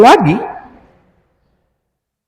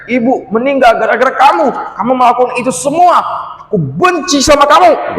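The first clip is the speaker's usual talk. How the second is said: furious through gritted teeth; 6 semitones higher than usual. Acted. Big sister finally exploding at her little brother, through gritted teeth. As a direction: spit it out through clenched teeth in rage. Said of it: "sambil menggertakkan gigi".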